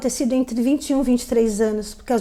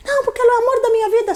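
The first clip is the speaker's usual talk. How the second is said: high, whiny voice of the ex begging at the door; ex-boyfriend begging her friends, high and whiny; pleading ex-boyfriend; 10 semitones higher, much faster than usual. Retold or imitated. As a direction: whine it much higher like a pathetic ex.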